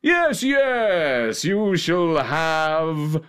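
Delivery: grand, drawn-out royal proclamation; effusive king with long swooping vowels; joyful king. A narrator's reading. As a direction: bestow it grandly, swooping high and stretching the words. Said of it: "said the king".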